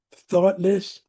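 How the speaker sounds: usual speaking voice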